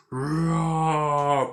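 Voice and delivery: mock monster roar; a short hammed-up Nemesis roar. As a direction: growl it like a hulking monster. Retelling Nemesis roaring.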